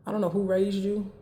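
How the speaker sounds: usual speaking voice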